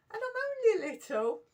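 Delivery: very high, loud mock wail; hammed-up small child's protest, pitched right up; little-child plea. Small child yelled at by teacher, high mock wail.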